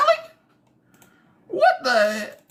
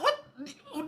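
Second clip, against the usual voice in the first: ordinary delivery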